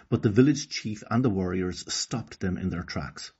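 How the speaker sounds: ordinary delivery, nothing marked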